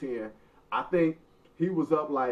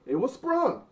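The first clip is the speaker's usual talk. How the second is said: insistent high protest; 10 semitones higher than usual. Real, not acted. Insisting his ankle was hurt.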